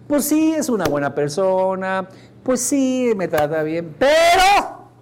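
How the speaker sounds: lightly voiced girl weighing it up, rising on pero; a hint of a girl talking herself out of it; voz de chica. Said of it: "a nivel profundo". (voz de chica que duda, aguda en el pero)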